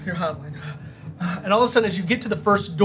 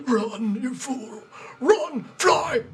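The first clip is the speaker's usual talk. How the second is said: put-on high cracked old wizard shout; very old man; 6 semitones higher, much slower than usual. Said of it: "this very old man coughing".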